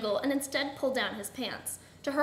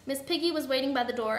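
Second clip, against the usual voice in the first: ordinary delivery, nothing marked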